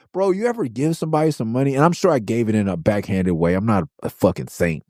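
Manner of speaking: ordinary talk, nothing marked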